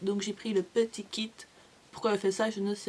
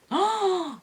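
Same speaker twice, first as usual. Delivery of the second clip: much higher, louder excited oh; delighted oh; 11 semitones higher, 4 dB louder than usual. Real, not acted. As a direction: gasp of delight at her favourite. Her favourite palette. (oh ravi, bien plus aigu et plus fort)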